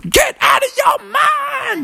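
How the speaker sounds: high, drawn-out chanted shout. Acted. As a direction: burst in yelling the party hook. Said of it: "started shouting".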